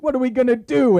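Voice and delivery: high, loud mock despair; panicked, high wail; crying priest. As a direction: cry out helplessly, hammed up. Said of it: "group of priests crying outside".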